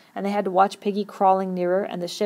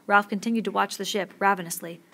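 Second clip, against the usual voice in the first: plain reading voice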